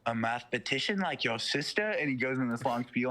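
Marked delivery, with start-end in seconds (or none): 0.0-1.9 devil taunting. Retold or imitated. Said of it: "he's like".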